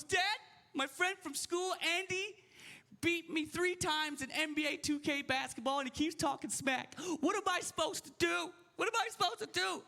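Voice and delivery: high-pitched imitation of an upset kid; complaining son. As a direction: plead to dad in a put-on, high kid voice. Son's complaint voiced in a story.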